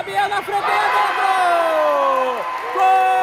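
goal shout. Real, not acted. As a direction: explode on the goal. Gol narrado ao vivo. (grito de gol)

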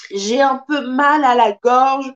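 ordinary talk

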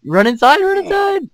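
high frantic yell to run; panicked shout. Real, not acted. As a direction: yell at him to get inside. Panicked yelling at teammate.

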